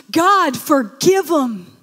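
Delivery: loud, slow, high cry for mercy; slow, loud plea to forgive; pleading prayer. Acted. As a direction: beg God aloud for them. Martyr's response to his attackers.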